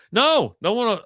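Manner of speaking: high, emphatic no; frustrated protest. Retold or imitated. Finder answering a commenter about the dog, exasperated.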